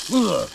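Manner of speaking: loud grossed-out ugh; disgusted ugh. Real, not acted. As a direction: groan of disgust. Disgust at mushrooms.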